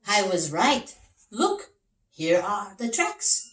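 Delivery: proud detective pointing out the tracks; eager detective Big Max. Acted.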